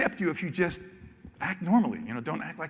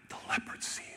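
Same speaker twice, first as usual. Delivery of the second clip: whisper; 12 dB quieter than usual. Acted. Ominous intro of predator.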